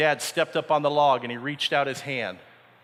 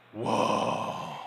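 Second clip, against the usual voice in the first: awed whoa; 4 semitones lower, 3 dB quieter than usual. Acted. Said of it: "All the guys in the boat are like".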